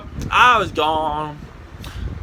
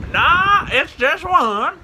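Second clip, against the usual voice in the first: very high, drawn-out put-on voice; second voice; 11 semitones higher, 3 dB louder, much slower than usual. Hidden prankster fooling the cops, high disguised voice. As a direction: pitch it way up to sound like someone else. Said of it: "when you can do multiple voices".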